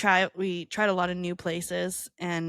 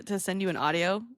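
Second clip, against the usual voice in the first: ordinary talk, nothing marked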